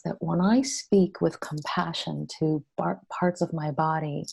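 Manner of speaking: ordinary talk, nothing marked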